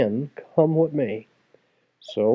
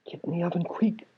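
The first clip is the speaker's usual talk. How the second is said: higher, softer frantic warning; urgent hushed shriek; 5 semitones higher, 6 dB quieter, faster than usual. A narrator's reading. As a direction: hurry him in without being heard. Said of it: "shrieked the ogre's wife".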